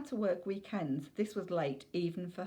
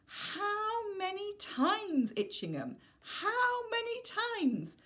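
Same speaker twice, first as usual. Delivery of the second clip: drawn-out, slow and much higher, like a fed-up mother; exasperated scolding; much slower than usual. Acted. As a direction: stretch each word, at the end of her rope. Mum scolds son over fire.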